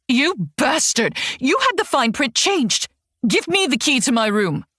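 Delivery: high, furious outburst at her husband; angry yelling. Acted. Wife yelling at her husband about the fine print, furious.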